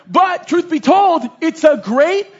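preacher swelling into full force; rising preaching. Real, not acted. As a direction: lift the room on this point.

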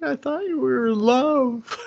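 high, whiny put-on sob; whiny crying girl. Imitating the girl crying at her boyfriend, whiny and high. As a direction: wail it in a high voice. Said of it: "She's just like".

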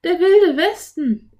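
high, loud, stretched-out exclamation; enthusiastic cry. A narrator's reading. Anne seeing the Wild West book, slow high thrilled cry. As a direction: savour it with loud delight. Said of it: "rief Anne begeistert aus".

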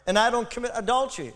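hammed-up self-righteous boast to God; smug Pharisee prayer. Preacher mimics the Pharisee praying smugly, mock-proud. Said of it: "prayed this prayer".

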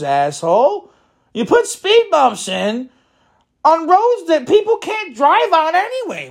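high-pitched, incredulous scolding; exasperated rant. Acted. Driver berating whoever put in speed bumps, high and exasperated. Acted rant at road planners.

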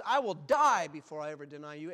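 loud pledge, hammering "die"; vowing. Acted. Preacher voicing Peter's vow to die first, loud and emphatic. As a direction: swear it forcefully. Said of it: "and, and vowed".

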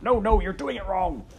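loud, high exasperated correction; shouted. A narrator's reading. Roscuro correcting Midge from her pocket, shouting. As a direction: shout to stop the botched speech. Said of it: "shouted Rescuro from Midge's pocket".

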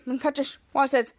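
usual speaking voice

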